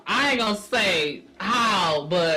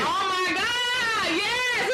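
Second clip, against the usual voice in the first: laughing cry; 9 semitones higher, much slower than usual. Real, not acted. Laughing at shared crying story.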